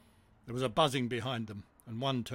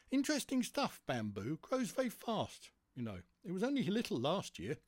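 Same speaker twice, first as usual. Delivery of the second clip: lightly voiced character, higher pitch; chatty story character; 7 semitones higher than usual. One chatters about bamboo.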